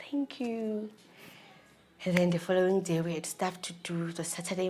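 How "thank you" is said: soft whispered thanks; whispered thanks to her husband. Retold or imitated. I got excited.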